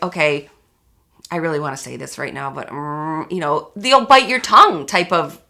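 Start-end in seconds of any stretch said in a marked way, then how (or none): 0.0-3.3 soft, fast, holding the words back; quietly swallowing the words; restrained murmur. Retold or imitated. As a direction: mutter it under your breath, biting your tongue. Holding back a harsh word.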